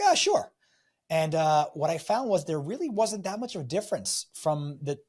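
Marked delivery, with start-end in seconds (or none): 0.0-0.5 high, louder hesitant yes; a startled, half-willing sure; reluctant shrug. Retold or imitated. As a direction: caught off guard, squeaky Israeli-free agreement. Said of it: "I'm like".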